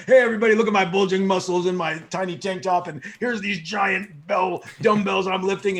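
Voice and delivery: loud, boastful showman voice; hammed-up Rock hyping himself; The Rock impression. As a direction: flex and brag to the crowd. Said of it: "He's like".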